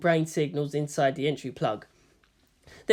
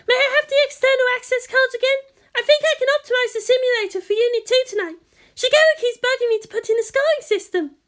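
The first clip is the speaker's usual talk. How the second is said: put-on light, much higher girlish voice for Maya; eager assistant to her senior, higher voice; young Maya voice; 5 dB louder than usual. A narrator's reading. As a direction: play the eager junior assistant, high and bright. Maya asks a favour.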